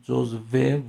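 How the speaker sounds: جزوه as joz-VE, v clearly sounded; pronounced with v. Shown as a demonstration. Teacher explaining spelling from sound, v pronunciation. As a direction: say جزوه with a v and no hamza. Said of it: "و تلفظ کنیم".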